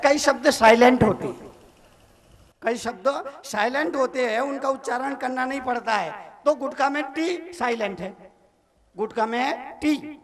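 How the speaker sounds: ordinary talk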